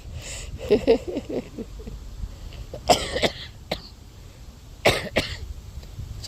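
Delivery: rueful unvoiced laugh; breathy laugh. Real, not acted. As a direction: laugh it off, half giving up. Laughs off losing her garden.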